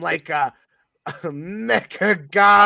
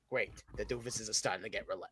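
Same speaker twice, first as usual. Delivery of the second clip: ordinary talk, nothing marked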